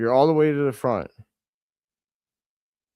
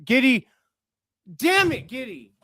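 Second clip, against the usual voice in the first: a frustrated shout that jumps way higher; angry yelling; 8 semitones higher than usual. Real, not acted. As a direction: yell at the player for blowing it. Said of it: "Sorry for yelling at you guys".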